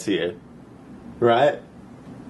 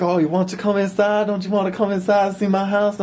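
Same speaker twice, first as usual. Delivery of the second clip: put-on higher, inviting girly tone; higher, coaxing voice inviting him in; coaxing girl voice; 8 semitones higher than usual. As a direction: coax him inside sweetly. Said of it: "So she's all like".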